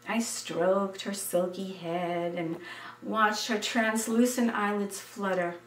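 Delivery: plain reading voice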